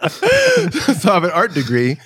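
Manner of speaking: laughing through the words, slowly; amused, laughter breaking into the words. Real, not acted. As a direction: laugh it out at his own joke. Laughing at own degree.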